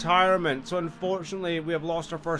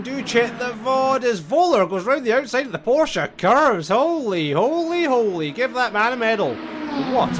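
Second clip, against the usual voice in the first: louder and higher, building to a thrilled peak; excited commentary; 7 semitones higher, 5 dB louder than usual. Real, not acted. Commentator hyping the pass round the outside, thrilled shout. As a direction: lift the voice as the move sticks. Overtake round Porsche curves.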